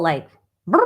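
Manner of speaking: lips buzzing a dizzy brrr; a quick buzzing lip trill, head spinning. Pretend.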